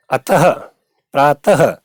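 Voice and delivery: अतः and प्रातः each ending in an audible "ha"; "a-ta-ha", "praa-ta-ha" for अतः, प्रातः; sounded visarga. Shown as a demonstration. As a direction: release the ः of अतः and प्रातः as a jerked h with an echo vowel. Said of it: "ऐसे विसर्ग का उच्चारण".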